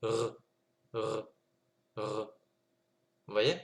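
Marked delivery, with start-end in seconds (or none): 0.0-2.3 r, r: consonne seule sans voyelle; bare r. Shown as a demonstration. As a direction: faire rouler le r et s'arrêter. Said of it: "ça donnera tout simplement r". (r seul, sans voyelle)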